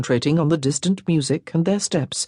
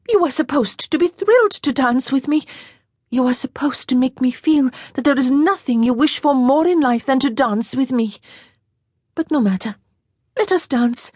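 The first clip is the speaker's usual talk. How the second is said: put-on lightly higher girlish voice for Jeanne; young French girl, a little higher; 8 semitones higher than usual. A narrator's reading. Jeanne teasing.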